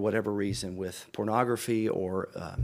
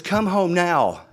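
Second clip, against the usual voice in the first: loud, high, slow mock wife's order; urgent command; 8 semitones higher, 9 dB louder, slower than usual. Wife ordering her confused husband home from the bank, loud and urgent. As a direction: imitate a wife calling out a firm order, stretching each word.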